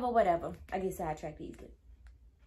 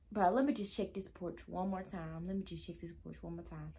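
low self-talk fading very soft; muttering to oneself; 5 semitones lower, 9 dB quieter than usual. Retold or imitated. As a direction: mumble it to yourself, softer on the repeat. Retold talking to herself, fading.

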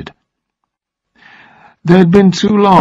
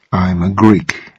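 slow, soft and gentle; gently; 6 semitones lower, 3 dB quieter, slower than usual. A narrator's reading. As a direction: reassure her slowly and gently. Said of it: "he said gently".

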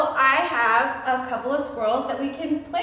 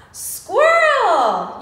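excited reveal; 7 semitones higher, 7 dB louder than usual. Real, not acted. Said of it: "guessing game reveal".

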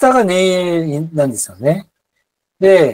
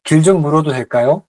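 fast Korean; much faster than usual. Shown as a demonstration. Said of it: "teacher models fast Korean".

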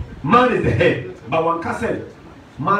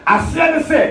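raised, high-pitched pulpit voice; impassioned preaching; 5 semitones higher, 4 dB louder than usual. Real, not acted. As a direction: preach it out to the whole congregation. Preaches at full force.

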